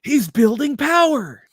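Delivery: very high, slow dramatic commentary; anime-style commentator. Pretend. Host imagines calling a whole show, hammed-up anime commentary. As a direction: stretch it out in a high anime announcer voice. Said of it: "it would be hard to stay in character".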